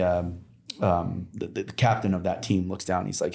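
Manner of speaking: normal talking voice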